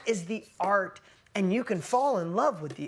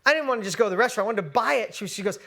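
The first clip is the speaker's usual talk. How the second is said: usual speaking voice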